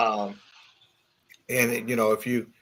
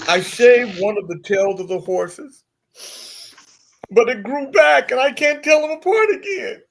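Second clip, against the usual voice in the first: crying; 8 semitones higher, 6 dB louder than usual. Acted. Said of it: "The man stops crying and says".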